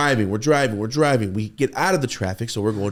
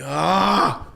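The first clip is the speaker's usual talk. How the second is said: short loud frustrated cry, high; one high yelp of stress about being late; frustrated yell; 8 semitones higher, 6 dB louder than usual. Retold or imitated. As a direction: let out a burst of exasperation.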